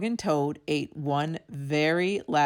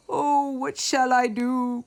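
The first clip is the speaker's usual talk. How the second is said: cried; 6 semitones higher, 3 dB louder than usual. A narrator's reading. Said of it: "cried Toad".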